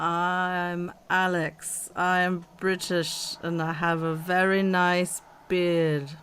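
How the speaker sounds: slow, deliberate mock British accent; playful British-accent imitation; Alex. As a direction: try your best British accent, drawn out. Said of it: "I'll try to do my best accent".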